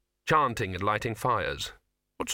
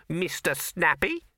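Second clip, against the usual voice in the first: slower, mocking rabbit voice; a hint of a sneer on the name; sarcastic rabbit; 8 semitones higher, slower than usual.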